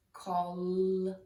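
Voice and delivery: ordinary delivery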